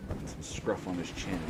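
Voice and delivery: usual speaking voice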